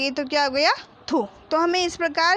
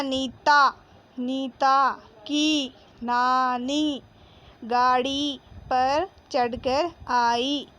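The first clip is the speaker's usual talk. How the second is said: put-on very slow, word by word, for young pupils; slow reading aloud; 3 semitones lower, much slower than usual. Shown as a demonstration. Teacher reading the lesson line to kids, slow. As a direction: read it out slowly so children can follow. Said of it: "reading aloud slowly to pupils".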